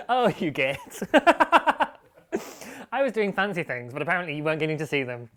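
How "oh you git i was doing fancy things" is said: loud, helpless laughing jibe; real laughing. Real, not acted. Host ribbing the producer, laughing hard. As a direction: cuss them out through big laughs.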